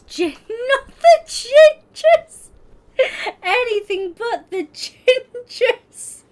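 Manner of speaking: high, loud, helpless laughter through slow words; real hysterics breaking up every word; can't stop laughing. Real, not acted. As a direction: squeal it out while cracking up. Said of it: "Sorry, I can't stop laughing".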